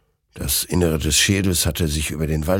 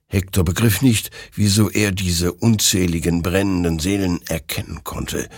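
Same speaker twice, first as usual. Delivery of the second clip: ordinary delivery, nothing marked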